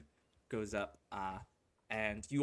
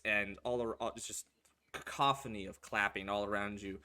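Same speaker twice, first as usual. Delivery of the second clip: ordinary delivery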